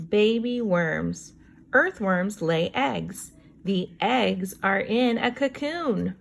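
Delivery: put-on slow, clear read-aloud for kids; reading to children. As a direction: read each word out slowly for young listeners. Teacher reads book text slowly.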